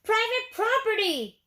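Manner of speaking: yelled. A narrator's reading. Cactus yelling at the tortoise, high and loud. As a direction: yell to keep them off. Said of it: "yelled Hank".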